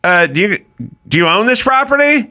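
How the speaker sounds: lightly voiced busybody checking on him; nosy neighbor. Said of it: "was like".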